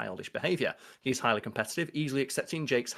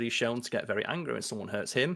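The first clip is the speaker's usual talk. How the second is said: ordinary talk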